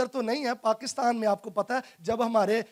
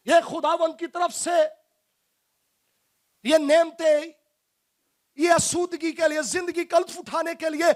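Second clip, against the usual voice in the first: raised, emphatic sermon voice; fervent preaching; 5 semitones higher than usual. Acted. Preacher warning the congregation that blessings come from God, emphatic. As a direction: press each point home at a higher pitch.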